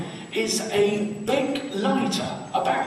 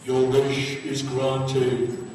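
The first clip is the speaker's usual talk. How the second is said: lower, very slow, eerie genie voice; 9 semitones lower, much slower than usual. Acted. Genie answers the American from the bottle, low and very slow. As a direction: boom it out slowly from inside the bottle.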